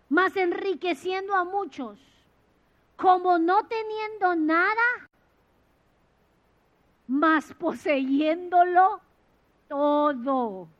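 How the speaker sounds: slow, loud declaration of scripture; slow loud proclamation, stretching mas; solemn scripture recital. Acted. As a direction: proclaim each phrase slowly.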